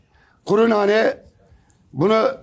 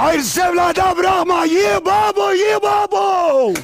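shouting; 8 semitones higher than usual. Acted. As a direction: bellow it like a street crier. Osman's shouted folk saying.

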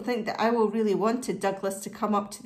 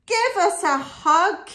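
higher, louder call for a hug; loud storybook cry; eager cry; 7 semitones higher, 6 dB louder than usual. Acted. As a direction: cry it out to the whole flock. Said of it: "cried Douglas".

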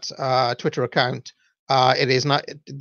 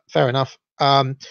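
usual speaking voice

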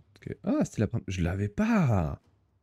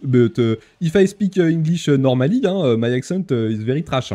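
loud, higher English with a heavy French accent; badly accented English, halting and French; thick French accent; 5 semitones higher, 7 dB louder than usual. Shown as a demonstration. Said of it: "si je parle français".

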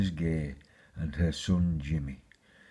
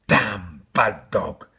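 slow, loud scolding of the dog; cross exclamation; 7 dB louder, much slower than usual. A narrator's reading. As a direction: scold the dog, punching each word. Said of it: "exclaimed Jimmy".

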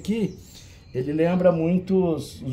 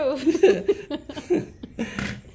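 high laugh; 7 semitones higher than usual. Real, not acted. Laughing at Carol's reply.

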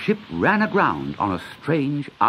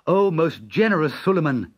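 put-on polite boy; 4 semitones higher, 3 dB louder than usual. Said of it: "said Dick".

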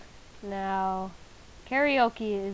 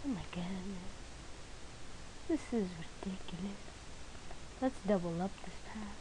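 whispering; 2 semitones lower, 11 dB quieter than usual. Real, not acted. Muttering to self, unvoiced.

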